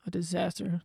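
a quiet anxious thought under the breath; worried inner voice. Retold or imitated. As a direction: murmur your fear to yourself. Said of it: "So I was like".

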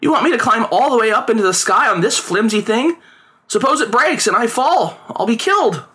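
hammed-up scared child; frightened boy. A narrator's reading. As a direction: plead against the climb. Boy continues protesting.

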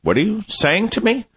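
put-on high, puzzled little girl's voice; daughter's baffled reply. Dad imitating his baffled daughter's question. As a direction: mimic a confused child asking why. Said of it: "My daughter looked at me like".

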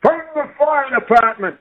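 usual speaking voice

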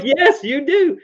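mock-stern mom putting her foot down; a parent snapping back at a teenage daughter, firm and high; firm parent retort. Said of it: "And I'm like".

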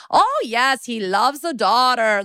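put-on high, slow Russian-accented landlady; old Russian lady. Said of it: "does she seem like she's Russian".

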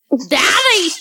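high, cried-out DADDY with lisped d's; toddler mispronunciation. Retold or imitated. Parent imitating son calling dad, high lisped DADDY. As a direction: call DADDY like a small child, d's thickened to "th". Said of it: "That's how he says daddy".

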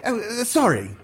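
high, fumbling apology; the bearded visitor, suddenly embarrassed; flustered apology. Acted. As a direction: stammer out a quick sorry. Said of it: "he concluded".

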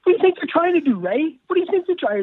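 high-pitched exasperated rant; squeaky-high ranting imitation; Jim Mora. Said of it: "I sounded like, uh, like Jim Mora".

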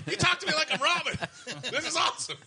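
very high, loud fanboy outburst; losing it, starstruck. Retold or imitated. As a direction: explode with giddy fan excitement. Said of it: "So I'm losing my shit".

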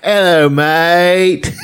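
slow, high British slacker voice drawing out hello mate; imitating the goofy British kid, stretched and lazy. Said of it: "Remember this kid?".

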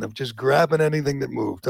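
usual speaking voice

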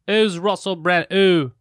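slow British "Is Russell Brand", who said without its H; mock British accent. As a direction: stretch it out in a hammed-up British accent. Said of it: "but they say ooh".

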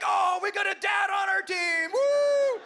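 put-on very high, loud kid's shout; cheering kid. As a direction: whoop it like a kid who just found a dad on the team. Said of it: "the next kid pipes up".

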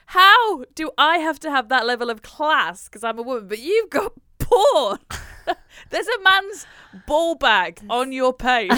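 mock-outraged retort, loud; loud, incredulous telling-off; retold indignant rant. To the man on her page, a loud indignant retort. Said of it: "I was like".

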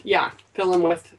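ordinary talk

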